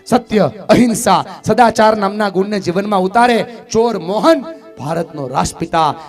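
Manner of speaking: loud, high, impassioned sermon to the crowd; preaching at full force. Acted. Speaker rousing the seated mothers, loud high preaching. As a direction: drive every line home to the hall.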